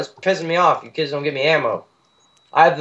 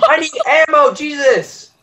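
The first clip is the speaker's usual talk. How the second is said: frustrated yell; 11 semitones higher than usual. Real, not acted. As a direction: shout it out in frustration. Frantic for ammo mid-fight.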